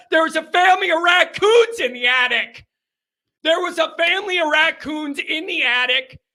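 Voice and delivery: big excited payoff of the haunting story; excited reveal. Real, not acted. Punchline reveal of raccoons.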